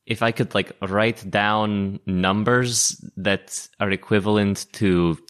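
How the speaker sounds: ordinary talk, nothing marked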